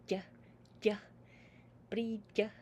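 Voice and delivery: "bridge" ending on a soft "ja". Shown as a demonstration. Teacher shows the soft Irish word ending. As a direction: end "bridge" softly like "bree-ja". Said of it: "a very soft way of ending the sound".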